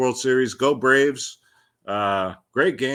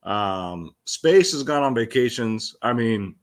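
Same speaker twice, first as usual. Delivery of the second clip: ordinary talk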